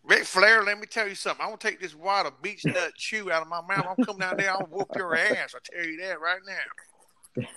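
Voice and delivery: high, hollering Southern wrestler threat. Acted. Wrestler trash talk promo.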